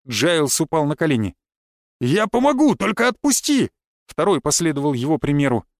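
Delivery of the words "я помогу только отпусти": high, urgent begging; desperate plea. A narrator's reading. Second arsonist begs the hero for release, desperate. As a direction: beg at a much higher pitch.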